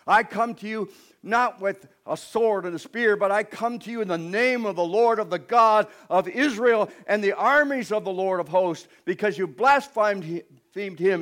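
David's bold challenge. Acted. David defying the giant, loud bold proclamation. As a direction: declare it like a fearless young warrior. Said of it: "and he says".

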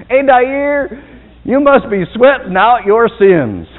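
high, loud teasing jab at a friend; jokey mock-sermon teasing; teasing joke. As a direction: rib him with a grin. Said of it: "I looked at him and I said".

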